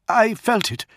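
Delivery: high, agitated voice; alarmed. A narrator's reading. Prato stares at the lion, high and alarmed. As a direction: blurt it out, badly shaken. Startled officer after crying out.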